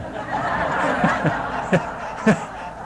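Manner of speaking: breathy laugh mid-story. Real, not acted. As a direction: laugh at the memory. Laughs while telling snow story.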